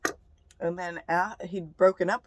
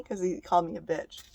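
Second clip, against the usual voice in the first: ordinary talk, nothing marked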